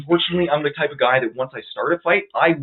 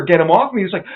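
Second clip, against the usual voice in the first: usual speaking voice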